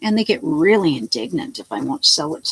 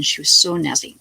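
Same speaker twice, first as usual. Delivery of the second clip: ordinary delivery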